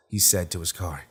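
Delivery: ordinary delivery, nothing marked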